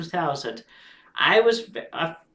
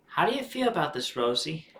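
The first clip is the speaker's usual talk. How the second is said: ordinary delivery, nothing marked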